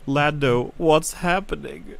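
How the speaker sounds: a hint of helpless confusion, drawn out; dying droid impression. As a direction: lightly imitate a droid's last confused words. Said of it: "while she's shaking and saying".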